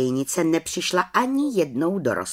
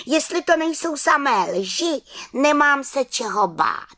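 high defiant confidence; 9 semitones higher than usual. Acted. Little witch defiant continues.

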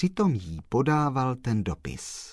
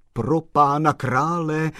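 slow, louder and higher cry of alarm; slowed, raised pro pána krále; exclaiming; 7 semitones higher, 6 dB louder than usual. A narrator's reading. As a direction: exclaim in shock. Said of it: "zvolal pan Kolbaba".

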